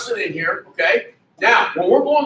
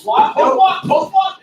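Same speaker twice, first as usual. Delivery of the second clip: shouting; 9 semitones higher, 4 dB louder, slower than usual. Real, not acted. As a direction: coach yelling instructions from the mat. Coach yelling during live wrestling.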